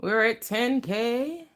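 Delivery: slow, high celebratory singsong; singsong announcement. Real, not acted. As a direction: drag it out in a happy chant. Celebrates reaching ten thousand.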